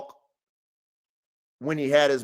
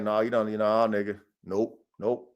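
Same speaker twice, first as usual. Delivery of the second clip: low warning; 8 semitones lower, 6 dB quieter than usual. Retold or imitated. Warning the dogs off.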